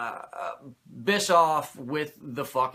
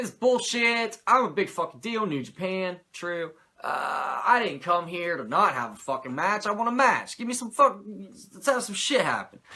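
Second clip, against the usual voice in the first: mock angry rant; 7 semitones higher, 4 dB louder than usual. Angry [name] demands a match.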